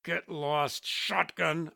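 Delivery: words pushed out in a grunt. A narrator's reading. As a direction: grunt out a warning. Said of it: "I grunted".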